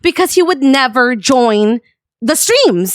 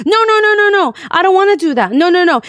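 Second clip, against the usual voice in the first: high-pitched, mock-alarmed string of noes; imitated panicked refusal; 6 semitones higher, faster than usual. Friend refuses to set a boundary, mock panicked. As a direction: plead against it, imitating him.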